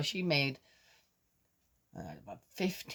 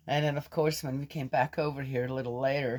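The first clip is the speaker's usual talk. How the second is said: ordinary delivery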